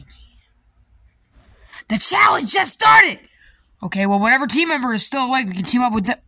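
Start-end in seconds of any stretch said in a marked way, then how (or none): 1.9-3.2 exasperated shout. Acted. Complaining at sleeping team.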